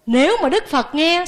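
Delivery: usual speaking voice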